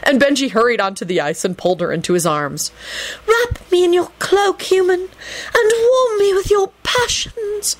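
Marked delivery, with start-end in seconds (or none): 3.3-7.7 high, slow, breathy-sounding temptress; seductive nymph. Acted. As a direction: lure him in, high and slow. Naiad voiced by narrator.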